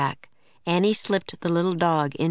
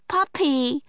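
delighted; 8 semitones higher than usual. A narrator's reading. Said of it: "he said with a huge smile".

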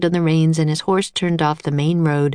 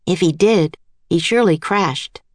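usual speaking voice

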